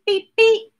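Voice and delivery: plain reading voice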